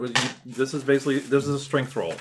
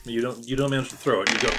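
normal talking voice

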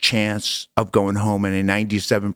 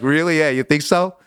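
higher, mock-incredulous come-back; sarcastic retort; 6 semitones higher than usual. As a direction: throw the question back, dripping with sarcasm.

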